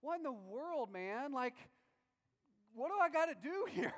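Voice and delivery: incredulous cry with a laugh; exasperated Moses. Retold or imitated. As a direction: throw up your hands in disbelief. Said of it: "And Moses is like".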